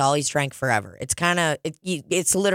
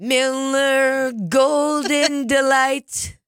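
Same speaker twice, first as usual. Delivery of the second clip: slow, higher, louder savoring; slow relish; 10 semitones higher, 4 dB louder, much slower than usual. Pretend. Mock-announcing the silly beer name, slow and higher. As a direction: roll the name out slowly.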